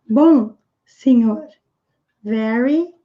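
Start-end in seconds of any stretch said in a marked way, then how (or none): none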